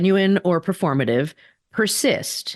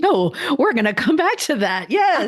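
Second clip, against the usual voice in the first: tag laughing; 8 semitones higher than usual. Real, not acted. Co-hosts laughing at playbook joke.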